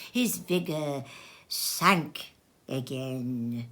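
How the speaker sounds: ordinary delivery, nothing marked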